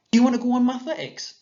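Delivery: put-on high, fast, lively child's question; voicing Esther. Shown as a demonstration. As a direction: read it as an eager big sister asking.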